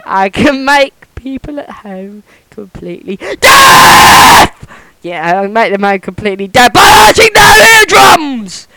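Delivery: high, loud yelling that builds to screams; deafening shouting. Shown as a demonstration. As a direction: shout to deafen the listeners at home. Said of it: "how it feels to be deaf".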